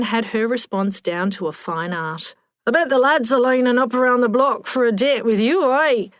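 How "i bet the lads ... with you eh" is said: put-on thick Scottish accent. A narrator's reading. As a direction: chat her up in broad Scots. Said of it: "in a thick Scottish accent".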